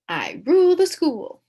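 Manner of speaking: usual speaking voice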